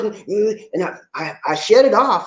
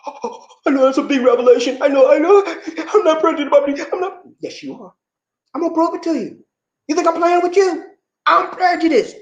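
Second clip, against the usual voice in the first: mock drama; 11 semitones higher than usual. Hammed-up exaggerated reveal.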